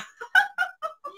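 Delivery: shrill giggle spilling out; high cackling laugh. Real, not acted. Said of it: "Cười đau bụng á".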